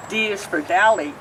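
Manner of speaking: ordinary delivery, nothing marked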